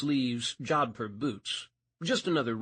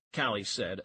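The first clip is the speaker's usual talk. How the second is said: usual speaking voice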